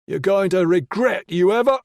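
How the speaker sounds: high, loud sneering threat; menacing attacker. Acted. Attacker taunting the helpless man, loud sneering threat. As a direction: taunt him like you hold his life.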